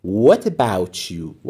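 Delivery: very slow, clear question; slow model phrase. Shown as a demonstration. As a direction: draw each word out for learners. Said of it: "teacher models phrase".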